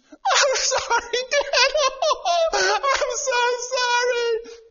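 put-on very high, slow little-girl voice with a giggle; hammed-up squeaky child begging forgiveness; drawn-out falsetto kid saying sorry; daughter's apology.

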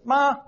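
MA held high and level. Shown as a demonstration. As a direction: say ma up high, without bending. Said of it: "high tone".

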